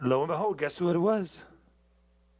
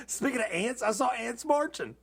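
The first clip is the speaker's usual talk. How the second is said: playful pun; 10 semitones higher than usual. Real, not acted. Pun on [name] song.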